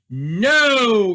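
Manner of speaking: loud, sharp No; a hard flat loud retort; curt retort. Acted. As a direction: snap the refusal back at the boy. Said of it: "retorted Mister Mean".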